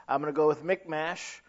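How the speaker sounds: ordinary delivery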